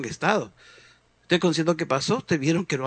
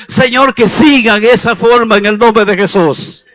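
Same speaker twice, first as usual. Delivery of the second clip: loud, driving preacher's call and response; fiery preaching; 2 semitones higher, 12 dB louder than usual. Acted. Preacher exhorts the congregation to search the Bible, loud and fiery. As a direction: preach it out at full force.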